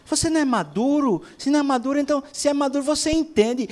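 high-pitched, pressing mimicry; imitated cajoling partner. One of a couple pressing the other about maturity, imitated high. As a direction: mimic someone pushily talking a partner round, pitched high. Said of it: "ouvi uma pessoa falando assim".

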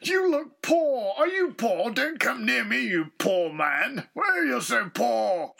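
haughty mock-posh snob; posh accent. Shown as a demonstration. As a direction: look down on someone in a posh accent. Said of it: "It's a posh accent".

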